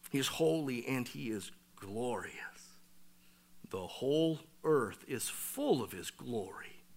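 whispered, reverent; an awed whisper; hushed awe. Real, not acted. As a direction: breathe it out in awe. Awed hush over God's holiness.

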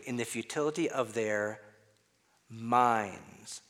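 pause, then "minds" set apart; stress on minds. Shown as a demonstration. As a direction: hold back before "minds", then land it alone. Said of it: "read through that quick and emphasize some other words".

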